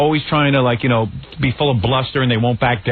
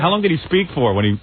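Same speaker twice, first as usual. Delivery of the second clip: ordinary talk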